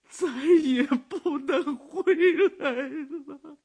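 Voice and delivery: high, tearful words breaking into sobs; sobbing. Acted. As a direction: weep out the news of the son's death. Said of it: "一边抽泣着". (抽泣着说)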